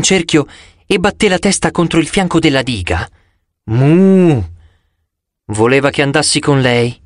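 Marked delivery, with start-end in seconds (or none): 3.6-4.5 imitated long cow's moo; urgent moo. A narrator's reading. Sea cow urging the narrator to follow, urgent moo. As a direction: moo to hurry someone along. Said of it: "Mi stava dicendo di affrettarmi".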